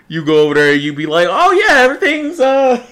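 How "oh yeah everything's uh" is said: much higher, loud nervous squeak; flustered Deku. Retold or imitated. Diner answering the waiter, squeaking like Deku. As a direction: squeak it out like a flustered anime boy. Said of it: "turning into fucking Deku".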